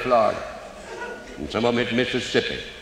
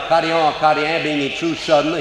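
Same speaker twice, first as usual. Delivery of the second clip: ordinary talk, nothing marked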